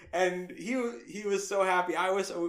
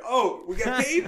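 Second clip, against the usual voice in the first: slow, high, loud surprise; pleasant astonishment; 8 semitones higher, 8 dB louder, slower than usual. Retold or imitated. New hire learning the job pays, astonished. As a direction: marvel at an unexpected bonus.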